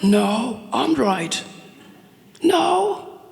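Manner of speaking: high squeaky voices trading no, I'm right; mock bickering. Two friends arguing over a song, high mock squabble. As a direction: play two kids yelling over each other, laughing. Acted quarrel, both sides.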